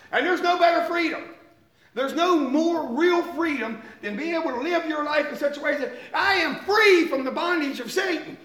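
high, exultant pulpit delivery; fervent preaching. Real, not acted. Preacher rejoicing in freedom from bondage, fervent. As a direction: preach it out with rising praise.

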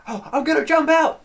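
high, loud panicked yell; hammed-up threat to bail, squealed; retold freak-out, pitch way up; mock panic. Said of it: "Paul Giamatti is freaking out".

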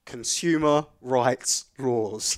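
very slow, word by word; deliberate reveal. Real, not acted. Revealing to a friend the three winning words, slow and emphatic.

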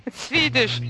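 ordinary talk

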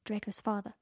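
ordinary delivery

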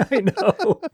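high giggly laughing agreement. Real, not acted. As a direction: laugh through the words. Laughing at the lyric.

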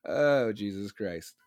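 ordinary talk, nothing marked

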